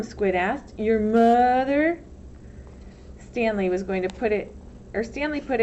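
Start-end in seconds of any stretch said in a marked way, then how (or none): 0.8-2.0 mocking boy. A narrator's reading. Squid mocking Stanley.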